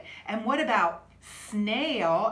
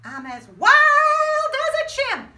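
much higher, louder with a laugh; wild and laughing; 14 semitones higher, 9 dB louder than usual. Acted. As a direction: go bananas like a chimp. Wild chimp line.

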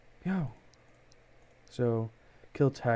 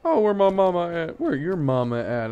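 loud playful taunt, pitched up at the start; taunting shout; 4 semitones higher, 7 dB louder than usual. Real, not acted. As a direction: call out to the enemy like a bully. Taunting enemy while playing.

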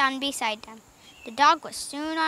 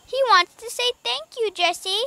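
high, delighted exclamation; girl crying out eagerly; happy cry; 9 semitones higher, 4 dB louder than usual. A narrator's reading. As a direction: pipe up in a raised, louder voice. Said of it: "cried Violet".

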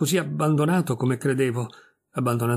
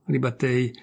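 ordinary delivery, nothing marked

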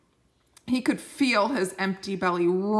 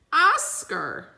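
called out; 9 semitones higher, 5 dB louder than usual. Acted. Elder calls the chosen name.

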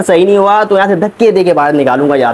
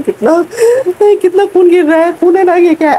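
much higher, squealing voice; shrill panic; 12 semitones higher than usual. Acted. Actor showing the director his bleeding in a shrill squeal. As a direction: cry out at the blood as if hurt.

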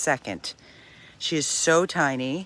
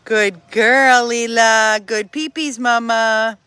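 higher, loud sing-song praise; gushing pet praise; 4 semitones higher, 7 dB louder, slower than usual. Real, not acted. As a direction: praise a puppy like a proud parent. Praising dog for peeing.

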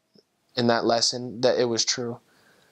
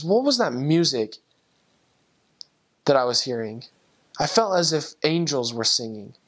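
usual speaking voice

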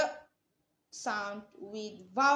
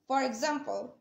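ordinary talk

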